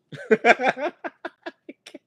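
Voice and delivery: loud high rising laughter. Real, not acted. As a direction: crack up at your own joke. Laughing at own joke.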